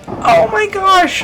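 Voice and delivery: high, slow, drawn-out dismay; mock exasperation. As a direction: stretch it out in exaggerated exasperation. Reacting to coworker's loud hello.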